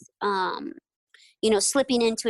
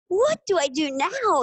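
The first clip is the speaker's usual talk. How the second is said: stunned; 6 semitones higher, 4 dB louder, slower than usual. Retold or imitated. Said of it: "I was just like".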